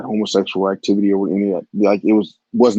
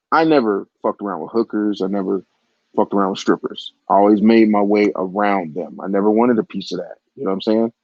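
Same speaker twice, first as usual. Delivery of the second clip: ordinary talk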